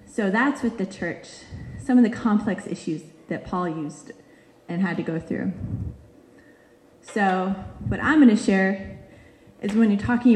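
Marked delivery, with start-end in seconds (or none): none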